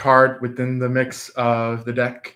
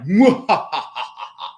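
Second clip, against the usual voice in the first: a short hammed-up villain cackle; mock evil laugh; maniacal evil laugh; 4 semitones higher than usual. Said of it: "this maniacal laughter of evil".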